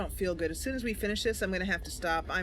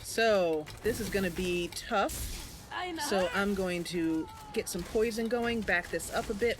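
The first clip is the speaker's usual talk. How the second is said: ordinary delivery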